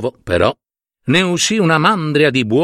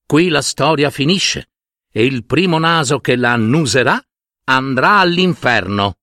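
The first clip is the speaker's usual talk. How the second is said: usual speaking voice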